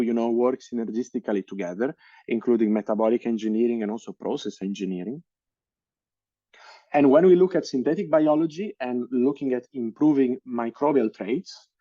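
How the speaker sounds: ordinary talk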